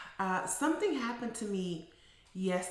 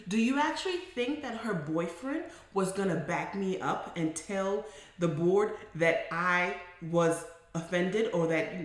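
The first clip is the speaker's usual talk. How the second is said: normal talking voice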